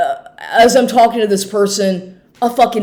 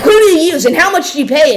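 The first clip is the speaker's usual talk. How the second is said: rapid-fire, higher-pitched mocking questions; mock interrogation; 7 semitones higher, 5 dB louder, faster than usual. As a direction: imitate a pushy grilling, trailing into nagging gibberish. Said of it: "interrogating the shit out of her".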